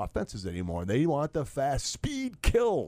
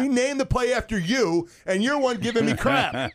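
raised, loud protest; indignant; 10 semitones higher, 3 dB louder than usual. Real, not acted. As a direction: push back hard, voice up high. Teasing back at co-host.

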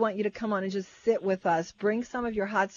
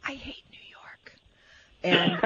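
leaning in to whisper; hushed confession, slow and unvoiced; 2 semitones lower, much slower than usual. Real, not acted. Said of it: "I feel like I should whisper this".

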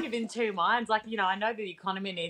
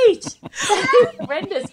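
high, loud, slow protest breaking into laughter; drawn-out, embarrassed cry of disbelief; retold mortified reaction; 11 semitones higher, 4 dB louder, slower than usual. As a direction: laugh through a mock-appalled exclamation. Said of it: "I'm like".

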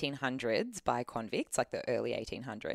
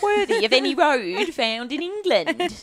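high, slow mock Cockney Oliver Twist; Cockney urchin voice; 12 semitones higher, 10 dB louder, slower than usual. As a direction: beg like a Victorian street urchin. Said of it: "It was Oliver Twist".

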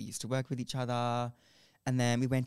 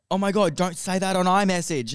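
mock panic; 7 semitones higher, 9 dB louder than usual. Mocking panicked dealer boyfriend.

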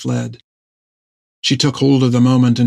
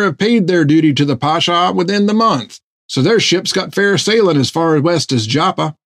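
higher, cheery boasting voice; eager sailor; 5 semitones higher than usual. A narrator's reading. Topper boasting to Jack about the baker's daughter, higher and cheery. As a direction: brag a little, chirpy.

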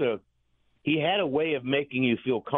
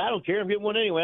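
higher-pitched mock insistence; retold stubborn retort; 9 semitones higher, faster than usual. Manager insists on getting coffee, retold high-pitched retort. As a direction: imitate someone brushing off a warning.

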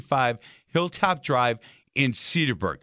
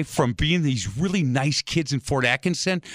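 ordinary talk

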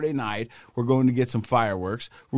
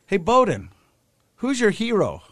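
higher, louder sing-out to a brother; imitated daughter; 9 semitones higher, 4 dB louder than usual. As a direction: coax like a kid fishing for an answer. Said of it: "my daughter, who was trying to".

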